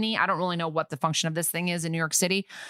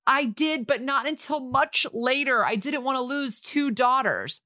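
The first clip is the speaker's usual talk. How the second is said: higher-pitched defensive plea; mock pleading confession; 7 semitones higher than usual. Mother admitting to her daughter what she knew, mock pleading.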